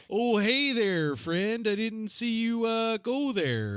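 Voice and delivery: hammed-up Minnesotan greeting, slowing at the end; Minnesota accent impression. Shown as a demonstration. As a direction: put on a friendly Minnesota accent. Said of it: "I'll do an impression real fast".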